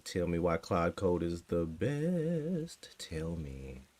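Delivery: slow whispered plea; hushed, slow coaxing whisper. Pretend. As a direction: whisper it like a secret request. Mock-pleading to the AI.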